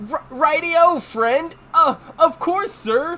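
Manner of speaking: high, stammering, flustered employee; shaky nerd. Acted. Rookie answering a customer, shaky and nerdy. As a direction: stumble nervously into eager politeness. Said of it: "he's kinda like a shaky nerd".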